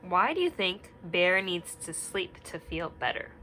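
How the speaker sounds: usual speaking voice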